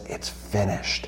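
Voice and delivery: very soft, slow, breath-led quote; hushed last words. Retold or imitated. Speaker quotes Christ's final moment on the cross, hushed. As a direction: let it out as a hushed dying declaration.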